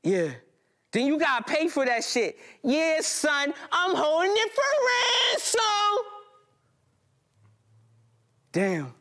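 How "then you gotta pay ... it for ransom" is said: high, mocking thug on the phone; taunting caller. Robber phoning the narrator about his heart, high mocking taunt. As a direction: taunt him down the line.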